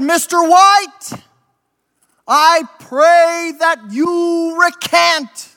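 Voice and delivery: slow, high pleading bishop; a bishop urging him to recant, drawn out. Acted. Bishop to prisoner in his cell, slow high plea.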